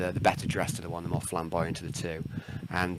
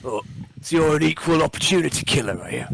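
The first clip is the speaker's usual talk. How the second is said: orc voice; 7 semitones higher, 7 dB louder than usual. Acted. Orc questions the stranger.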